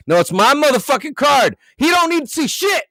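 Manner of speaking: high, loud indignant outburst; angry snap. Retold or imitated. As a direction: mimic a sassy customer snapping. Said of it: "you were doing it in Kayla fashion".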